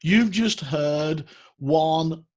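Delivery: slow, weighty announcer drawl; mock announcer. Host to viewers, slow mock announcer voice. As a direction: announce it like a grand trailer. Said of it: "announcer-style slow build".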